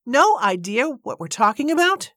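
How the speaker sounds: high, incredulous indignant echo, rising at the end. A narrator's reading. Woman echoes the man's dismissal, indignant and disbelieving. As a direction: throw it back as an outraged question. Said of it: "Miss Braithwaite was full of indignation".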